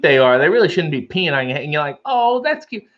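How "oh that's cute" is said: high-pitched mock coo; much higher, cutesy little reaction; acted cooing. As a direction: react as if charmed by something tiny. Acted reaction to peeing ladybug.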